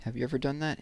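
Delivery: ordinary delivery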